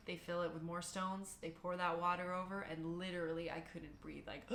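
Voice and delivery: ordinary talk, nothing marked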